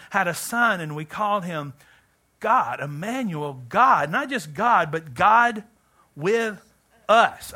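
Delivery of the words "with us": ordinary talk, nothing marked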